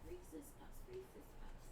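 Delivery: whispering a cat's name. Real, not acted. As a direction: hush it, coaxing the cat over. Calling cat softly at night.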